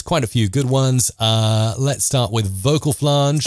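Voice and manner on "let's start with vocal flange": normal talking voice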